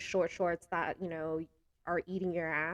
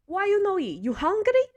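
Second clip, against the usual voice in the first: put-on much higher, louder accented nonna voice; Italian grandma; 12 semitones higher, 8 dB louder than usual. Grandma scolding a guest for not eating, shrill-high mock nonna voice. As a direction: push food on them like a fussing nonna. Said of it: "She'll be like".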